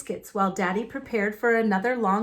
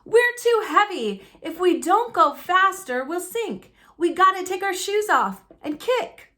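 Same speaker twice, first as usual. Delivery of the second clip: urgent; 8 semitones higher, 4 dB louder than usual. Acted. Urgent plan in sinking raft.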